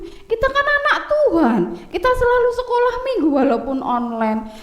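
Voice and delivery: put-on high child's voice, sing-song and urging; earnest little brother. Oci urges his brother to tell the truth, high and earnest. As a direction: persuade your brother like a good Sunday-school kid.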